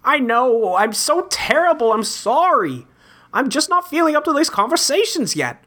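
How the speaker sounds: normal talking voice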